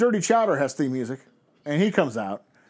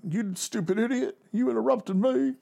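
mock L A Knight; 8 semitones higher, 4 dB quieter than usual. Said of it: "he's like".

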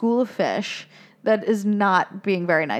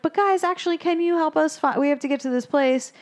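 Dory voice; 7 semitones higher than usual. Retold or imitated. Dory asks fish for help.

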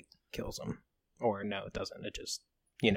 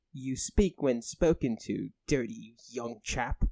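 put-on British accent; 4 semitones higher, 4 dB louder than usual. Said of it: "I do a great British accent".

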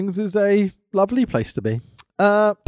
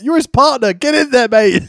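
mock heckling call; 6 semitones higher, 3 dB louder than usual.

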